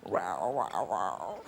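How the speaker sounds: very low, soft growly "rawr"; little girl saying I love you in lion talk. Retold or imitated. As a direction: growl softly like a lion cub. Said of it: "That's 'I love you' in lion talk".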